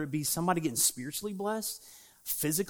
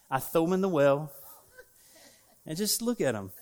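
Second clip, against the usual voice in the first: ordinary delivery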